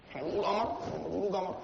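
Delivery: قمر said with qaf, then جمر with a hard g like an Upper Egyptian. Shown as a demonstration. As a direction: softly contrast قمر and جمر. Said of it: "الراجل الصعيدي ده بينطق القاف". (نطق القاف قاف وبعدين جيم صعيدي)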